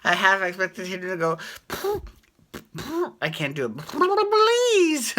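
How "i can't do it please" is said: high squeaky cartoon rabbit plea, "Please" shot way up; attempted Roger Rabbit voice, "Please" squeaked up high. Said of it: "I don't do a good Roger Rabbit impersonation".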